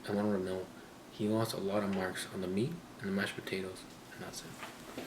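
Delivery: hushed secret whisper; whispering while hiding. Real, not acted. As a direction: whisper so he can't hear from outside. Brother outside, secret scoring.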